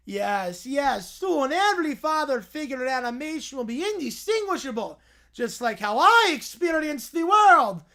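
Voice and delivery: booming cartoon villain unveiling his invention; Doofenshmirtz villain voice. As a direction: ham up the evil scientist's big reveal. Doofenshmirtz continues.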